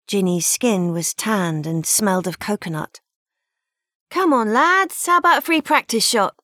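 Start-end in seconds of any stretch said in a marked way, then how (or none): 4.1-6.4 high, loud, quick call to the lads; cheeky stallholder calling out. Acted. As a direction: beckon the boys across the pier. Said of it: "she called".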